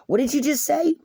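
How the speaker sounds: high-pitched, rising startled question; startled double-take. Acted. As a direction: act out someone whipping round in disbelief. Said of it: "somebody swiveling around going".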